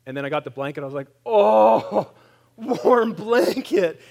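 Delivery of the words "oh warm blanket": high, loud, slow laughing delight; blissful sigh. Retold or imitated. Patient in hospital savouring a warm blanket, blissful. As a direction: melt into the warmth.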